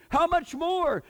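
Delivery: high, loud, emphatic voice of God; God pleading. Retold or imitated. God telling believers he still loves them, high and loud. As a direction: insist like a father who won't let go.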